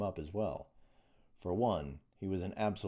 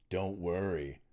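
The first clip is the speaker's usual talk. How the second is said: deep and mocking; slower than usual. A narrator's reading. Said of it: "his voice deep and mocking".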